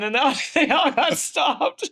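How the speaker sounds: high, drawn-out laughing delivery; amused. Real, not acted. Teller recalls friends halted at the club door, real laughing voice.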